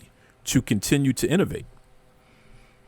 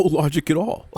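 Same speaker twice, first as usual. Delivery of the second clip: normal talking voice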